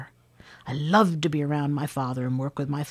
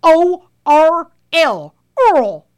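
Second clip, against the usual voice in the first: very loud, high, slow spelled-out holler; exasperated shouting; 11 semitones higher, 10 dB louder, much slower than usual. Retold or imitated. Father spelling for the guy who wouldn't understand, shouting. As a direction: shout each letter apart, then the word. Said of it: "his voice got louder and louder, and he shouted".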